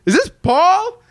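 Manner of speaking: very high, loud incredulous question; acted shocked realization. Child spotting uncle under Santa costume, acted shocked realization. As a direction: blurt it in hammed-up disbelief.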